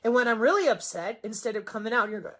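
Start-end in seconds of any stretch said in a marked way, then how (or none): none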